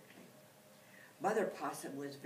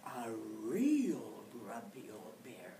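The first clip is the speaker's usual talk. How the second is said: put-on a real grumpy old bear said slow and low, leaning on real; real, slowed and weighted against the pretend bear; stress on real; 5 semitones lower, slower than usual. A narrator's reading.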